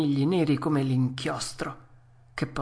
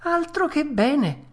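high-pitched, drawn-out delight; elated puppet; 13 semitones higher, 4 dB louder than usual. Acted. Cured puppet thanking the fairy, high elation. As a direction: rejoice in a high child's voice, slowly.

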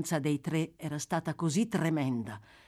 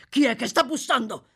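sudden higher, louder frightened question; startled alarm; 6 semitones higher, 6 dB louder than usual. Acted. Sykes hears knocking at the door, startled and alarmed. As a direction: jump at the knock.

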